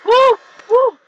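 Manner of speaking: high, loud frightened yelps; sudden scared whoops; startled shriek. Real, not acted. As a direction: yelp in real fright at a spider. Startled by spider on wall.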